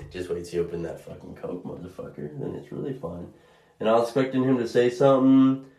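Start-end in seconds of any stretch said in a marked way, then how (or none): 0.1-3.3 smug quiet aside. Real, not acted. Smug aside about the Coke.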